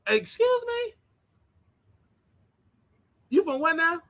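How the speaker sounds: high incredulous question; mock puzzled. Resident answering the nurse, high and baffled. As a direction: act baffled at the knock.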